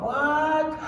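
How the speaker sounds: high squeaked what; mock astonished reaction; a startled mock what; disbelief. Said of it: "I thought".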